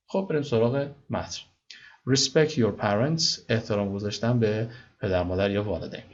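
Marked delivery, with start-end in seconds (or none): none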